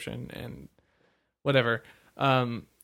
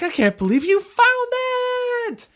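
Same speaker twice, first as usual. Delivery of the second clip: hammed-up mom gushing; 8 dB louder than usual. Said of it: "she's like".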